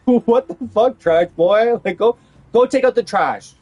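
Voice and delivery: loud, scornful ordering about; mocking shout. Imagined retort to a co-worker about the trash, mocking shout. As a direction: raise the voice and jeer at them.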